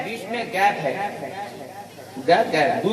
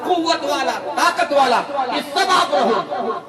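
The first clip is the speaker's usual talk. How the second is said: fiery preaching; 5 semitones higher than usual. Acted. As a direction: preach it higher and higher to the congregation. Preaching at full force.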